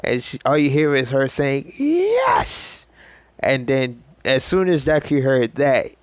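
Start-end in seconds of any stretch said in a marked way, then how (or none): 1.8-2.8 a sudden yelp, much higher; shrill cry of joy. Retold or imitated. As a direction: shriek it in triumph. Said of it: "screaming very loudly".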